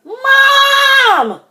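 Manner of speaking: high, loud wail for mom; drawn-out high yell; crying out. A narrator's reading. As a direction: wail it long like an upset child. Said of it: "cried Froggy".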